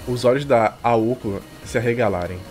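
normal talking voice

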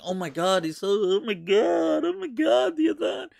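hammed-up imitation of someone overcome with emotion; mock drama queen. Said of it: "Stop being such a drama queen".

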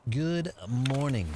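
stretched, lightly voiced morning greeting; a hint of a radio host's greeting; slow put-on greeting. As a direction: drawl it out playfully.

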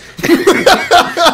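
loud, high burst of laughter. Real, not acted. Lacht über traurigen Spielverlauf. (lautes, hohes Auflachen)

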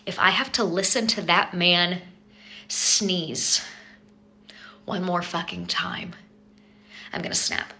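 seething quiet. Real, not acted. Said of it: "building fury, fading to whisper".

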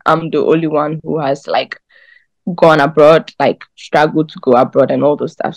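usual speaking voice

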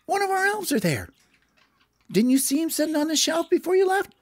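fast, high elf voice; quick squeaky elf; Gerald. Acted. Elf continues.

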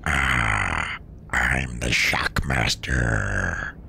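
very low, very slow bass rumble; extremely deep bass voice. Shown as a demonstration. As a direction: drop as low as the voice goes. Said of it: "that is the lowest voice I can make".

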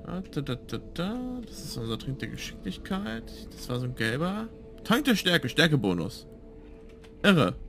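ordinary delivery

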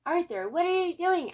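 high, loud startled question; surprised librarian. A narrator's reading. The librarian catching Arthur in a cape, startled. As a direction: sound alarmed at what he's up to.

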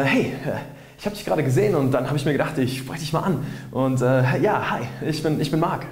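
hesitant opener as if reading it off somewhere; stilted, put-on. Shown as a demonstration. Approaching a stranger to introduce himself, stilted and put-on. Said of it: "klingst du von vornherein komplett aufgesetzt".

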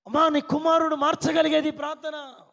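high, loud, shouted preaching; fiery preaching. Acted. Pastor thundering at the mothers in the congregation, loud and high. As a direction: build each line to a roaring climax.

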